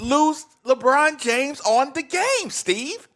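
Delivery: high-pitched indignant complaint; throwing a fit. Real, not acted. Griping to Steve about using LeBron, throwing a fit. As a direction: protest hotly, pitch jumping up. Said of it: "you do throw a fit".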